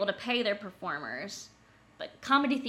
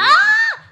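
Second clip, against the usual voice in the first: high loud delighted shriek; excited squeal; 18 dB louder than usual. Real, not acted. Excited scream about mics.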